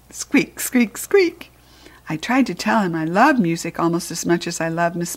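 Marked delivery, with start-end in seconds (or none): 0.1-1.5 small high squeaks rising at the end; squeaky hamster. A narrator's reading. Hamster squeaking back to the janitor, high and small.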